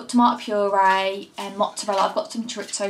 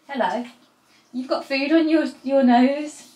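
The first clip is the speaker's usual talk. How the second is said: teasing; 5 semitones higher, 5 dB louder than usual. Real, not acted. Teasing partner off-camera.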